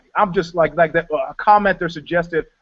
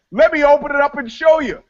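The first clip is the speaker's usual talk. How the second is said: retold eager reply; 8 semitones higher, 3 dB louder than usual. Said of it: "I go".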